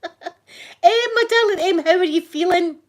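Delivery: loud, high-pitched delighted hail; big, high, loud hello; calling out greeting. Real, not acted. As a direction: call out fondly to a friend just arriving. Calls out to greet arriving friend.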